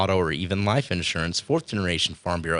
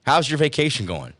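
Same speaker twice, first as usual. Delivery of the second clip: ordinary talk, nothing marked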